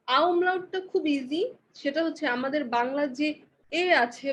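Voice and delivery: ordinary talk, nothing marked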